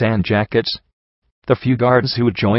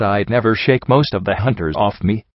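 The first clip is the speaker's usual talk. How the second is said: usual speaking voice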